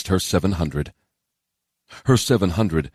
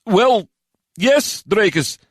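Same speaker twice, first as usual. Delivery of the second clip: high, hesitant answer; nervously halting, high-pitched; 4 dB louder, much slower than usual. A narrator's reading. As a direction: answer high, unsure before a commander. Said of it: "Georg answered nervously".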